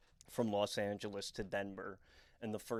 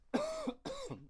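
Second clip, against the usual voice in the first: strained cough. Real, not acted. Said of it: "killing my throat chakra".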